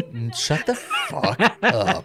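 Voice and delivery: laughing through the words; slow, amused brush-off through a laugh. Real, not acted. Laughing at co-host's joke.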